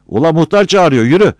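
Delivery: high, loud summons; urgent full-voiced holler; calling out. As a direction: shout an order across the yard. Villager calls Battal over.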